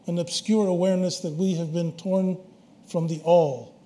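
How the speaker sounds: loud, measured reading in phrases with pauses; formal quotation reading. Acted. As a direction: read it out solemnly, phrase by phrase. Reads out a quotation.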